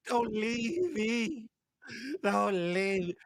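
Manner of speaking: slow, pleading mock movie line; overacted plea, drawn out; mock melodrama. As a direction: ham up the begging. Mocking Zeno's movie scene.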